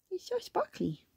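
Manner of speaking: tiny, high, soft voice; cooing. Pretend. Reader admires the book's picture, cooing. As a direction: marvel softly in a high coo.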